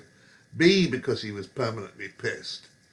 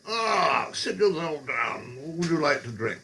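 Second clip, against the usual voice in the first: mock charmer; 5 semitones higher than usual. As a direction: play the old smoothie. Sharpie charming blind woman.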